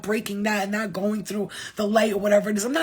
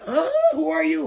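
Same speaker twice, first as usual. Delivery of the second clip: higher, slow, startled question; hammed-up wide-eyed surprise; mock surprise; 6 semitones higher, 5 dB louder than usual. Acting out own surprised reaction.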